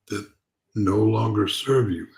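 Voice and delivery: ordinary delivery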